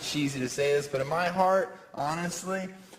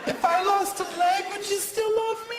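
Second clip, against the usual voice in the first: much higher whiny plea; mock girlfriend. Comic voicing a girlfriend testing his love, much higher. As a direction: ask in a high girly whine.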